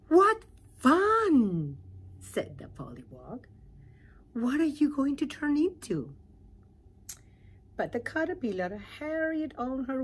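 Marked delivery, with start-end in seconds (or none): 0.1-1.8 much higher, louder squeal of delight; delighted little pollywog; excited pollywog. Acted. 4.3-6.1 higher, louder curious voice; curious little pollywog; eager pollywog. Acted.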